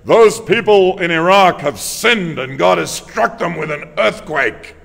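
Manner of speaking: mock American TV preacher thundering, slow and loud; fundamentalist American preacher. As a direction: drag out the condemnation like a fundamentalist preacher. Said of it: "the fundamentalist preachers will come on the news in America".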